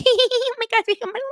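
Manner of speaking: very high squealing, giggling schoolgirl; giddy schoolgirl. Retold or imitated. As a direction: gush like a little girl in love. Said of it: "she is like a little schoolgirl".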